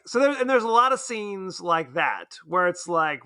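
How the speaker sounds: normal talking voice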